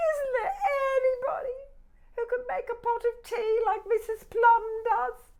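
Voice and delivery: high loud calling teapot. Acted. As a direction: call out hopefully.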